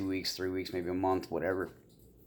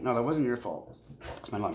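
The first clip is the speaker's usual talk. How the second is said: normal talking voice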